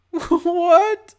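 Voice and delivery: high, loud, panicked squeal; terrified. A narrator's reading. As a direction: squeak it out in terror. Terrified victim under gun.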